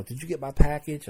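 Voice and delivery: mock pushy demand for a package. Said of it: "this woman would be all demanding".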